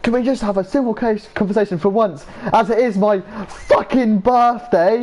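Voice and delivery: high, strained, exasperated outburst breaking into a laugh; mock angry Will. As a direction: imitate Will losing patience, half laughing. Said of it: "Will's, like, going angry".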